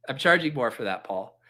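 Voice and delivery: ordinary talk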